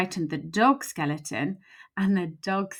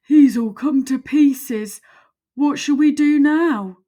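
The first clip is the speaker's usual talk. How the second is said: loud, higher, slow puzzled voice; big skeleton; 7 semitones higher, 8 dB louder than usual. Acted. Big skeleton frets over the dog in pieces, loud. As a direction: boom out the dismay.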